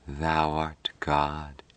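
very slow, soft and entranced; heavy drugged whisper. A narrator's reading. Patricia echoes Mike in a trance, heavy hushed murmur. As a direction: murmur it heavily, drawn right out as if drugged. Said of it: "Patricia whispered, in a voice as heavy as if drugged".

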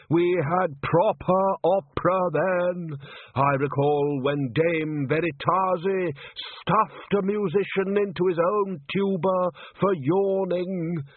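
slow, nostalgic old man, voiced lightly; wistful old conductor. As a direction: sigh over the good old days. Undershaft reminiscing.

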